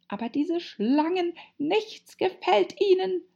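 very high, complaining bird voice; distressed pigeon. A narrator's reading. Pigeon lamenting about impossible snakes, distressed. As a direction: wail it high and exasperated.

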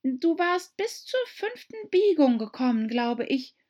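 put-on high, eager young girl voice; pleading girl reminding the mouse; Alice's voice. A narrator's reading. Alice to the mouse, high eager girl.

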